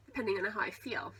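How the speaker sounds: ordinary delivery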